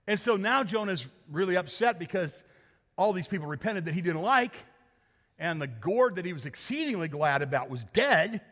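normal talking voice

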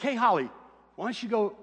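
light up with a bright idea and call her; eager call. Retold or imitated. Father calling to his daughter with a sudden idea, eager. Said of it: "I'm like".

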